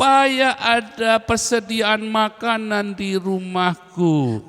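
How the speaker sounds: high, drawn-out scripture declamation. Real, not acted. Preacher quotes scripture to the congregation, high slow declamation. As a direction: proclaim the verse slowly, high and sustained.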